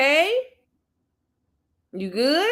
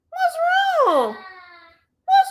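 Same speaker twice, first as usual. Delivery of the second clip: high, loud call of concern; high called-out what's wrong; 4 dB louder, much slower than usual. Real, not acted. Calling to crying child.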